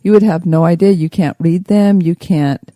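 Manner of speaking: ordinary talk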